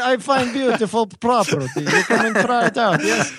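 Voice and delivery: put-on playful high foreign accent as Ben; mock Ben's broken English, lightly laughing; hammed-up accent impression of a friend. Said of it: "I should do the Ben accent".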